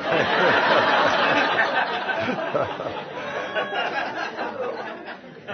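long high laugh; hearty laugh at his wife's reply. Real, not acted. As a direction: laugh freely at the joke.

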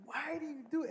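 high-pitched parental scolding without volume; mock yell. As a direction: imitate a yelling parent, high but held soft. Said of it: "when you yell at them".

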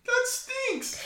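high, slow squeaky whine; silly whiny voice. Pretend. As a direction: whine like a sulky cartoon kid. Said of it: "happy and sad about our teams".

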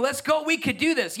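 rally them like a coach; Holy Spirit cheering you on, pumped up. Retold or imitated. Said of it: "He's cheering you on".

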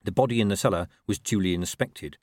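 plain reading voice

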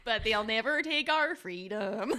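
slow Midwestern accent, each syllable spelled out; overpronounced, every syllable. Shown as a demonstration. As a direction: drag out "They may take our lives" syllable by syllable in a Midwestern accent. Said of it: "Just overpronounce every syllable".

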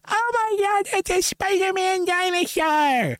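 put-on very high, slow squeal of a thrilled child; excited kid. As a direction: shriek it like an amazed little kid. Excited kid shouting from afar.

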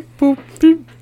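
high, loud robotic beeps; toy robot voice. Acted. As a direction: beep it like a little machine. Radio device beeping.

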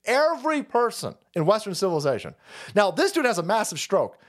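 usual speaking voice

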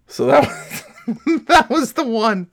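laughing. Real, not acted. Laughing after retold scream.

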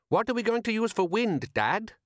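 high-pitched, doubtful son; young son. Acted. Son doubts his father's plan.

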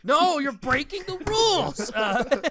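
high laughing protest. Real, not acted. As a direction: laugh out the words. Laughing through the remark.

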